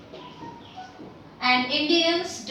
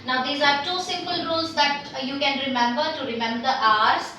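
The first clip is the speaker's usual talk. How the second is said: ordinary delivery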